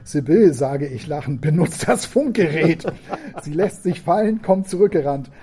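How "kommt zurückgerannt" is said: usual speaking voice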